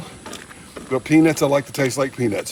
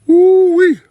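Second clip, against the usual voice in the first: high loud woo-wee; delighted whoop; 10 dB louder than usual. Real, not acted. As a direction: whoop at the taste. Tasting hot peanut.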